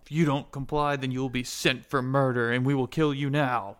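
loud, forceful ultimatum; acted angry warning; threatening guard. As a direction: deliver a guard's death threat, loudly. Guard threatens the player with death.

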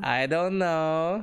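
put-on high, drawn-out Borat-Norwegian accent. Giving friends the accent's trigger phrase in Borat-Norwegian accent. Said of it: "things ... that trigger your accents".

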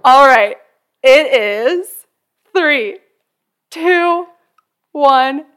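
building countdown to a big reveal; dramatic countdown. Real, not acted. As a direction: count down loudly, building suspense. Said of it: "we need, like, a drum roll".

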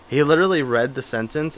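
ordinary talk, nothing marked

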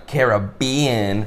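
"Caribbean" slowly, stretching the BE; drawn-out care-uh-BEE-un. Shown as a demonstration. Co-host realizes the answer, drawn-out "Caribbean" as care-uh-BEE-un. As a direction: draw out "Caribbean" as care-uh-BEEE-un. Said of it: "Carib-, oh, yeah".

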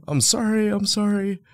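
mock pleading in a very high voice; high-pitched apology. Men hiding behind him from cops, high mock apology.

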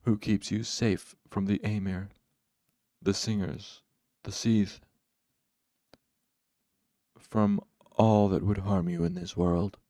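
polite, measured questioning; calm politeness. A narrator's reading. Haliax questions his suffering servant, calm and polite. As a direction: ask calmly, as if genuinely curious. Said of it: "Haliax asked with calm, calm politeness".

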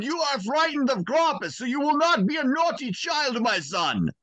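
put-on high, playful German accent; German father teasing his crying son, accented. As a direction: tease the child like a German father. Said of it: "in this deep German".

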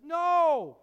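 high, loud anguished No; anguished cry. Acted. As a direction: cry it out in grief. Disciples grieving Jesus leaving.

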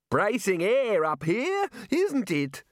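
put-on high, very slow booming old wizard; hearty Arch-Chancellor. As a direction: relish the fresh air like a jolly old don.

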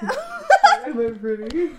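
high, stretched coy squeal, laughing; squeaky mock pretty voice. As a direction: ham up the coy question, slowly. Posing in a wig as a bird.

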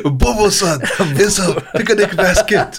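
high-pitched mock Japanese accent. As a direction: put on an exaggerated Japanese accent.

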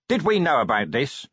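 a mild, sharp demand, rising at the start. A narrator's reading. Vimes confronting Cheery over the newspaper, mildly demanding. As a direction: press the question like a boss wanting answers. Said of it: "he demanded".

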